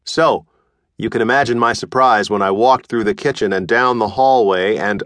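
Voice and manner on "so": usual speaking voice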